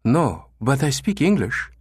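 lightly voiced woman answering; receptionist voice. A narrator's reading. Receptionist replying to the German caller, polite. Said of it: "came the reply".

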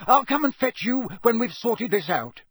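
high, loud shout over the din; father shouting over the noise to his sons. Acted. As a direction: yell above the chaos. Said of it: "Mister Weasley shouted over all the noise".

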